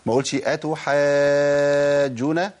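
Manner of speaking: أتحاجون drawn out slowly with a loud push on the jeem; wrong forced nabr. Shown as a demonstration. Teacher showing the forceful mistake. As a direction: shove hard on the jeem in أتحاجون. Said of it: "ده خطأ".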